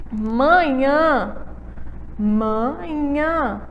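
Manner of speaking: manhã sounded out slowly, the final NHÃ held; stress on last syllable. Shown as a demonstration. Teacher showing which syllable is strong, manhã with long stressed ending. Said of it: "aí a sílaba forte é, é a final".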